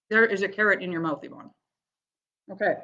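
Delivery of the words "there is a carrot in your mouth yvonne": ordinary delivery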